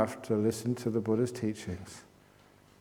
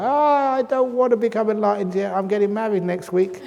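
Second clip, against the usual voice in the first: high, loud mock panic; comically frightened wail of protest; terrified; 10 semitones higher, 8 dB louder than usual. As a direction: play a terrified person pleading, hammed up. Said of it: "people get really terrified".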